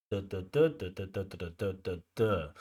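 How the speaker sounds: duh syllables tapping out the stress beats; stress rhythm beats. Shown as a demonstration. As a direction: tap out the sentence rhythm with duh, duh. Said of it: "that's what we call stress timing".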